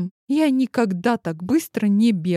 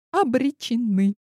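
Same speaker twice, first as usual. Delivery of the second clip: ordinary delivery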